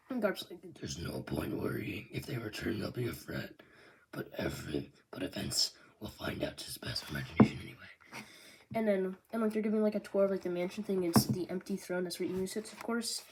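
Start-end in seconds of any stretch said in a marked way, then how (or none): 0.8-8.3 put-on much lower, hushed old man's rasp; a deep whispered old marine; gravelly Garp. Garp telling the Marines not to worry, low hushed rasp.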